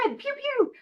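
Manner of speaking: high playful sound effects; playful pew pew. Pretend. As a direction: zap it like a toy laser.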